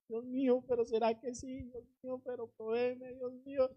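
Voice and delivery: soft mock whimpering prayer; lloriqueo imitado. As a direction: imitate a tearful plea under the breath. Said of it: "y sigue llorando". (lloriqueo suplicante fingido, en voz baja)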